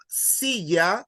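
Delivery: "silla" slowly, first syllable stretched; stress on SI. Shown as a demonstration. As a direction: lean on the "si" of "silla". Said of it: "how to stress a word".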